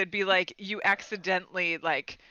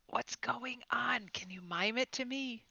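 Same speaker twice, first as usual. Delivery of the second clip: whisper; 5 semitones higher, 8 dB quieter than usual. Acted. Telepathic message to Noob.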